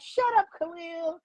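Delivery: a sulky sister telling him off; annoyed teen. Retold or imitated. Moody teen sister to teasing brother, irritated and high. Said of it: "she would be like".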